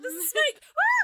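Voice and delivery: high, loud hammed-up panic; mock screaming. Imitating a boxer who spots a snake, mock screaming. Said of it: "he was like screaming".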